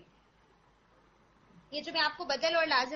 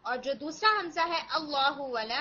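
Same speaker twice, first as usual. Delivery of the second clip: usual speaking voice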